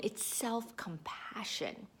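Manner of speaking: slow whispered reveal. Real, not acted. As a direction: whisper it slowly for weight. Whispered punchline after contrasts.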